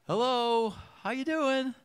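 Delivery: cheery greeting. Retold or imitated. Friend greeting the grumpy old woman, high cheery sing-song. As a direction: chirp it like an overeager neighbor.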